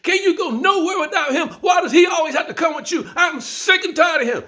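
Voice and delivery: put-on high, loud, furious girlfriend yelling; imitating her blowing up at him; furious shout. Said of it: "she went ballistic".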